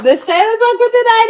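high and loud, hyped up; excited announcement. Real, not acted. To listeners, hyping the plug loud and high. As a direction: announce it big like a promo.

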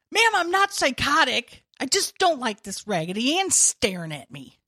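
loud protest, starting high; hammed-up exasperated insistence; mock indignant outburst. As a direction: defend yourself loudly to the therapist. Acts out arguing with therapist.

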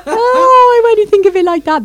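overwhelmed, giggling outburst; laughing squeal. Real, not acted. Host laughs at own remark.

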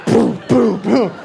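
loud, punchy booms; imitated banging. Panicked patient's hands banging, loud thuds. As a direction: boom it out like thumps on a wall.

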